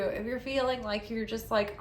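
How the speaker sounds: ordinary talk, nothing marked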